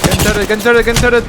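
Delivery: loud urgent callout; urgent shout. Real, not acted. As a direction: yell it to the team.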